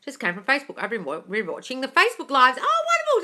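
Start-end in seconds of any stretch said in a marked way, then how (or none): 2.6-3.2 very high, loud squealed oh; delighted squeal. Real, not acted. Delighted reaction to viewer comment.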